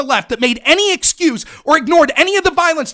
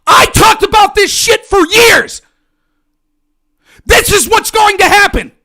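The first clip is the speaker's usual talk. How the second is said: angry shout; 5 semitones higher, 6 dB louder than usual. Real, not acted. Warning critics he predicted this, angry shout. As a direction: hammer it home, shouting. Ranting, warned them for years.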